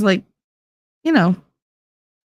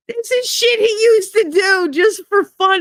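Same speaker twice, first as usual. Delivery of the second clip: exclaiming in disbelief. Real, not acted. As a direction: burst out high in disbelief. Laughing exclaim about his antics.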